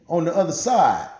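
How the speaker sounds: ordinary delivery, nothing marked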